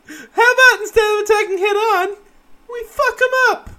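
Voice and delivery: very high, squeaky mock proposal; silly falsetto suggestion. Co-host mocking the guerrilla plan for laughs, silly high falsetto. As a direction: pitch it way up into comic falsetto. Joking paraphrase of the battle plan.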